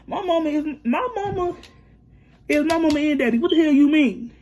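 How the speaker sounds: high-pitched, exasperated insistence; worked-up, indignant retort; indignant defence. Retold or imitated. Defends her mama to boyfriend.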